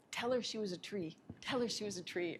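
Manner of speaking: softly voiced spirit message; soft insistent spirit. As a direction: quietly urge it twice.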